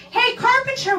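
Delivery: high teasing call of the nickname; imitating the boy. The crush on the phone teasing her, mock boyish greeting. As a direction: sing out the nickname like a schoolboy. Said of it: "he calls me up ... he's like".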